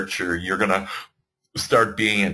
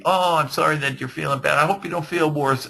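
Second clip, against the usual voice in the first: pitying; 7 semitones higher than usual. Retold or imitated. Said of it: "You don't go and pity".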